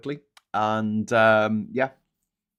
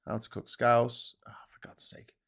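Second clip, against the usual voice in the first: whispered search; 7 dB quieter than usual. Real, not acted. Muttering while searching.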